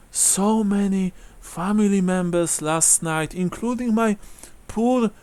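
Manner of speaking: put-on high, slow old woman, thankful then mournful; elderly widow dwelling on her loss; grieving old widow. Game master voices grieving widow.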